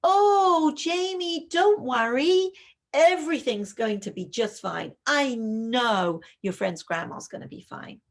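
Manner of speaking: high, loud, rushed false cheer; anxious over-reassurance. Shown as a demonstration. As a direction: gush reassurance too eagerly. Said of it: "which would be something like".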